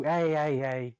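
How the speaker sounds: loud, sharp scolding at a pet; calling off a dog. Real, not acted. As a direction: raise your voice to stop the dog.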